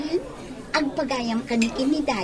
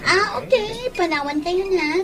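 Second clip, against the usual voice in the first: high, raised sing-out voice; airy leave-taking; 6 semitones higher, 4 dB louder than usual. Acted. Woman taking leave of Roland and his children, airily. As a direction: breeze out with a high lilt.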